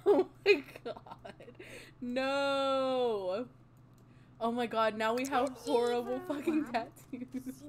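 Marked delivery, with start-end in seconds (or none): 0.0-3.5 loud slow laugh into oh my god; laughing in disbelief. Real, not acted. Laughing at tattoos.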